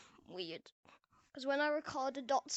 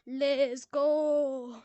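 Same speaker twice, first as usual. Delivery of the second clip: louder, drawn-out cheer; bigger cheer; 2 semitones lower, 5 dB louder than usual. Pretend. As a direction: stretch and belt the rallying call.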